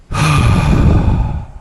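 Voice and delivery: heavy breathy sigh; long sigh. Real, not acted. Speaker pausing in a rant, long sigh. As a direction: let out a long exhale.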